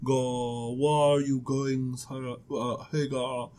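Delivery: low, very soft, fumbling angel. Acted. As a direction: stay in the hushed angel voice while stumbling. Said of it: "I need to come up with an angel voice".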